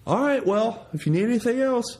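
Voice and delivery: high, mocking voice of a rambling man; mock talker. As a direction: lightly mimic the talker stalling goodbye. Mimicking a long-winded talker.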